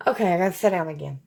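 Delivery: normal talking voice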